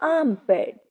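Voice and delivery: आर्मपिट said "aam-pit", with no R sound; silent R. Shown as a demonstration. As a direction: drop the R in आर्मपिट, long "aa" first. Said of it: "आर फिर से silent होगा". (आर्मपिट में आर silent, "आमपिट" जैसा)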